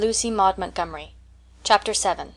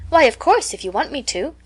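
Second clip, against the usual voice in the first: bright, higher, louder start; cheerful; 6 semitones higher, 4 dB louder than usual. A narrator's reading. Anne to Marilla, eagerly cheerful higher agreement. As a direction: chirp an eager yes. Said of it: "assented Anne cheerfully".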